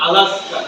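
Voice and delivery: "আলাস্কা" as one linked word; fast native linking. Shown as a demonstration. Teacher repeats for students, linked native "আলাস্কা". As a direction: run I will ask her together as "আলাস্কা". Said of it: "উচ্চারণটা হয়ে যায় এরকম".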